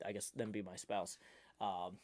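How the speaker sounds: ordinary delivery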